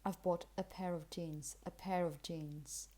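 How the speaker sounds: quiet, easy linking around "pair"; relaxed, weak forms. Shown as a demonstration. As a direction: relax "a pair of" into one run. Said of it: "Very relaxed and very weak".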